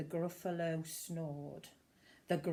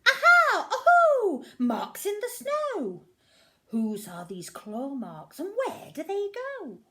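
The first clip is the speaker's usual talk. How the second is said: put-on high, loud triumphant child creature cry; a small monster thrilled at her discovery; very high and loud excited Aha; excited little Gruffalo's child; 13 semitones higher, 13 dB louder than usual. Child finds marks.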